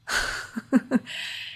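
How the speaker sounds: light, breathy little laugh; amused chuckle. Real, not acted. Kid screaming outside the window, amused chuckle. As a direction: chuckle at an interruption.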